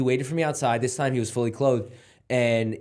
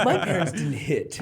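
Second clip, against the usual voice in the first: slow, laughing echo of a friend's words; laughing mockery; 3 semitones higher, slower than usual. Real, not acted. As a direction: repeat it teasingly through real laughter. Laughingly repeats friend's admission.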